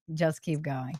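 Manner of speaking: "Just keep going" said low and soft, resigned; a weary shrug of a line; resigned quiet. Retold or imitated. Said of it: "we'll be like".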